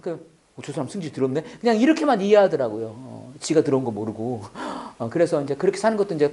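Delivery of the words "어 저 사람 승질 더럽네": low, soft aside imitating the kids; muttered reaction. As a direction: grumble it under your breath. (낮게 중얼거리는 뒷말 흉내)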